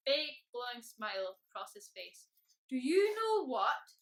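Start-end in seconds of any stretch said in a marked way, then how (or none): 2.7-4.0 slow, loud, raised dragon voice; cheerful boom. A narrator's reading. Happy dragon tells Mister Tickle at day's end, booming cheerfully. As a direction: boom it out happily and unhurriedly. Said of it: "he boomed cheerfully".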